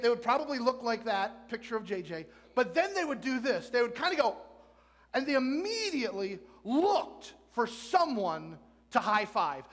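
ordinary talk, nothing marked

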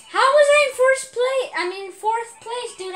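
high, loud protest; indignant disbelief. Real, not acted. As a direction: cry out in disbelief at the result. Outraged at his race position.

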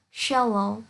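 "shallow" said slowly with a clear sh at the start; slow sh demo. Shown as a demonstration. Teacher modelling the sh in "shallow" for students. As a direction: stretch "shallow", opening it with a crisp sh. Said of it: "C sẽ được phát âm là sh".

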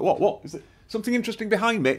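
high, fast, puzzled dog voice; dog's voice. Pretend. Dog answering owner who wants a photo, high fast puzzled voice.

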